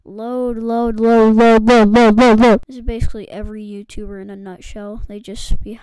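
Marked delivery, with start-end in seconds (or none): 0.0-2.6 chanting. Acted. Mimicking a loading sound.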